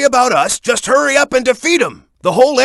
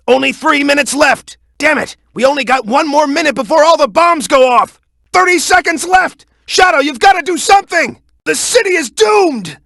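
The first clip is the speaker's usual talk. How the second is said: mounting panic; 6 semitones higher than usual. Acted. Ally watching the bomb timer run out, building to a panicked high shout. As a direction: panic more with every second lost.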